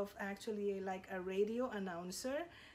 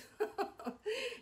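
a bright, high laugh that bursts out; high burst of laughter; 13 semitones higher than usual. Real, not acted. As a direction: laugh at your own joke. Laughs at her own joke.

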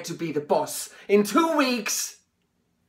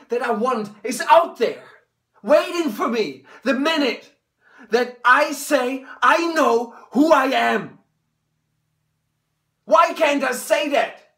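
higher and louder, tearful; crying out; 4 semitones higher, 4 dB louder than usual. Acted. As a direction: cry out, voice breaking. Emotional climax to father.

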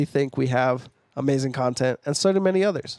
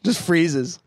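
usual speaking voice

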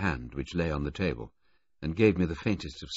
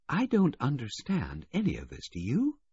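higher, puzzled woman's voice; Daffodil, murmuring; 9 semitones higher than usual. A narrator's reading. Daffodil murmuring her confusion to Mercer, puzzled. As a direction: ask it lightly, bewildered. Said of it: "Daffodil's voice said, murmuring".

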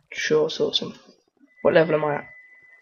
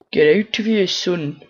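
rough London slang; 3 semitones higher, 7 dB louder than usual. Pretend. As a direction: yell it like a London geezer. Shooing off a mob in slang.

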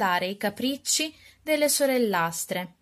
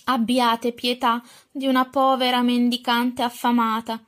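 ordinary delivery

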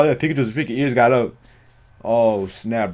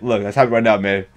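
normal talking voice

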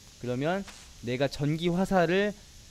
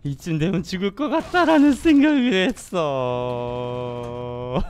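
ordinary talk, nothing marked

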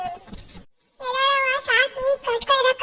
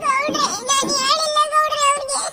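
crying plea; 5 semitones higher, 4 dB louder than usual. Acted. Mother pleads over eloped daughter.

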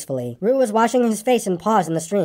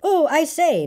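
high, fluttery exclamation; high-pitched excited outburst; excited noises; 9 semitones higher, slower than usual. A narrator's reading. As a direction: exclaim it high, all in a flap. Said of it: "jumping up and down and making".

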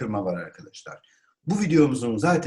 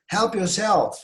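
high-pitched, projected invitation; exclamation; 8 semitones higher, slower than usual. Shown as a demonstration. Teacher shows the sound required, exclaimed. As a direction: HELP YOURSELF cried out high. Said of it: "Böyle bir ses çıkarmanız".

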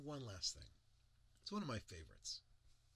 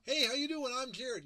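loud, high, exaggerated hey; mock copycat of his own booming intro; over-the-top greeting; 13 semitones higher, 11 dB louder than usual. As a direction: ham up a big presenter hello. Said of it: "walk up in front of a group of people going".

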